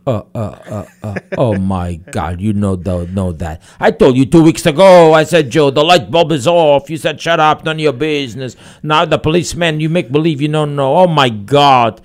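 high, loud wife exposing her husband; nagging wife. Acted. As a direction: start in disbelief, burst into loud scolding. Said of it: "The wife says".

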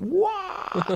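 high mock crowd cheer "wah"; mock crowd roar. Teller mimics the crowd's roar at the dunk, a high mock cheer. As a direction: imitate a roaring arena crowd.

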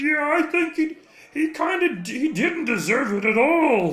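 high, loud rabble-rousing shout; angry mob leader. Pretend. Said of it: "can't be expressed in a shout".

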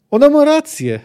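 high, loud urgent cry; shouted. A narrator's reading. Jaskier breaks into the quarrel, shouted. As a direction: shout it out, pitched well up. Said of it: "krzyknął Jaskier".

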